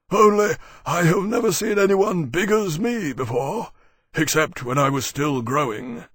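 big, high, loud creature voice; dragon Maximus, defensive. Acted. Maximus excusing himself to Temeraire, defensive.

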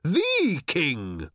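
THE stretched as "thee", high, then falling; shouted. A narrator's reading. Old man correcting Arthur, shouted stress on THE. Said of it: "shouted old Thrashbarg".

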